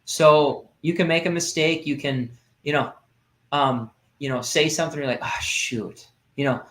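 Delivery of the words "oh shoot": whispered, softer, under the breath; muttered oh shoot. Retold or imitated. Parent catching a mistake mutters softly. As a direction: let it slip out under your breath.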